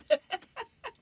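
short, high-pitched chuckle; real giggle at a comic punchline; amused laugh. Real, not acted.

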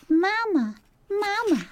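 very high tiny peep; peeping gosling. Acted. Newly hatched gosling peeping at the robot. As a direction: newborn chick calling for mama.

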